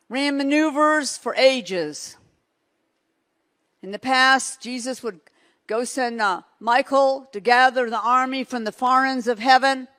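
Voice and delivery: loud driving preacher cadence; loud sermon delivery; preaching at full force. Real, not acted. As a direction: build the picture with power.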